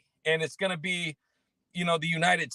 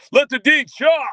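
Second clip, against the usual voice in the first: high, loud hammed-up name call; playful announcing shout; 11 semitones higher, 10 dB louder than usual. Host overdoing a corrected viewer name, playful high shout. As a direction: belt out the name like a hype man.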